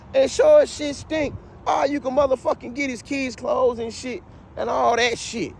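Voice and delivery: ordinary talk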